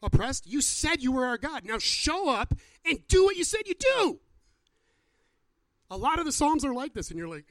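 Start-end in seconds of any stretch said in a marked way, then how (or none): 0.0-4.2 raised, high, loud challenge to God; impassioned loud plea demanding God act; David's indignant demand. Acted. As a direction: cry out in protest, building to a high shout. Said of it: "David says to him".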